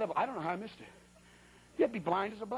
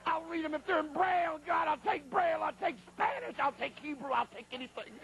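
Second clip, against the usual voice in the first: frantic bargaining; 7 semitones higher than usual. Retold or imitated. Trapped preacher bargaining with God, shouting then fading.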